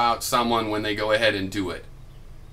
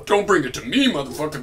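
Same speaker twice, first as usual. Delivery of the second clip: mock angry quote; 2 semitones higher than usual.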